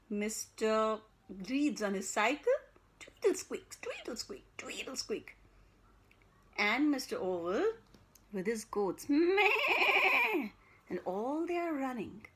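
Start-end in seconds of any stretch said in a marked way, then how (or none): 3.0-3.7 higher squeaky tweedle; squeaky bicycle. Acted. Storyteller making the cycle squeak. 3.8-4.4 much higher, soft squeak. Acted. Storyteller squeaking the bike high. 4.6-5.4 whispered tweedle squeak. Acted. Storyteller whispering the fading bike squeak. 9.1-10.5 high, loud bleat with a laugh; laughing goat bleat. Acted. Storyteller bleating the goat with a laugh.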